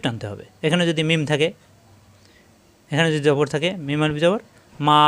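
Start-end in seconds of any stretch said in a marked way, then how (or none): 4.9-5.0 মা with a long held "aa"; one-alif elongation. Shown as a demonstration. Teacher shows the pull after mim, মা drawn out. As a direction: stretch the vowel of মা to double length. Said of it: "একালিফ টান হয়ে যাবে".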